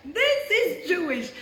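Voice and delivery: slow, high, emphatic declaration; certain. Retold or imitated. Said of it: "like, certain, like, when she knows".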